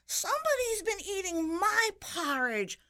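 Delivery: very high little bear voice; baby bear's high-pitched, pouty complaint; pouting baby bear. A narrator's reading. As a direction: pout it high as the smallest bear. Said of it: "pouted the little small baby bear".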